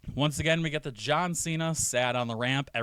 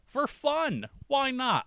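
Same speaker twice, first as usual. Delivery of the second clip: pitched way up, playful justification; high-pitched, carefree mock delight; mock gleeful; 12 semitones higher, much slower than usual. Gleeful Cena showing off in retelling.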